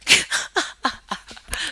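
real, loud burst of laughter. Real, not acted. Streamer cracking up at her own accent, laughing.

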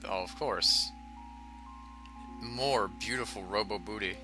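ordinary delivery

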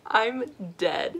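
high, loud, laughing line. Real, not acted. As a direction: laugh through the words. Laughing reaction to photo.

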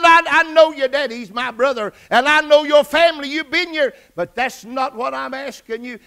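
high, loud voice of an exasperated aunt; insistent retort. Retold or imitated. Aunt answering her nephew's church credentials, insistent retort. Aunt argues back in retelling.

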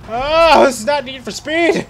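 high, loud, drawn-out cry; exasperated yell. Real, not acted. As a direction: yell it out, stretching the end. Exclaims while speeding out of control.

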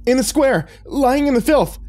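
very high, loud, frightened voice; squealing, distraught priest; panicked reply. A narrator's reading. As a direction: blurt the answer out in alarm. Panicked priest answers under pressure.

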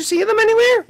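very high, small character voice; squeaky cartoon voice. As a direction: chirp like a tiny cartoon sidekick.

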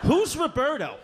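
high-pitched bewildered outburst; indignant, high confusion; baffled protest. Acted. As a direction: demand an answer, voice shooting up. Baffled son protests to dad.

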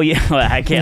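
usual speaking voice

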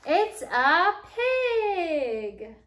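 very slow, loud, high drawn-out reveal; excited reveal. Acted. Teacher reveals the pig to the children, slow and loud. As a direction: stretch it out like a big surprise.

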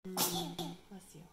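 usual speaking voice

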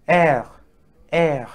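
R said loudly as "air"; French letter R. Shown as a demonstration. Teacher drilling the alphabet, loud R as "air". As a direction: project R as French "air", pausing between repeats. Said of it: "sounding R".

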